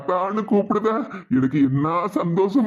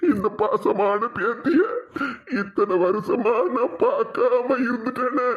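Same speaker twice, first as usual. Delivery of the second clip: acted sobbing grandfather's lament; crying; 3 semitones higher than usual. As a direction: weep with regret over lost years. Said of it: "அழாதீங்க மாமா".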